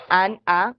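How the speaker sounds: "an, a" cortado en dos sílabas; sílabas separadas. Shown as a demonstration. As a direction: read "an, a" as two separate syllables, unlinked. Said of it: "si leo por separado esas dos sílabas". ("an, a" leído por separado, sílaba por sílaba)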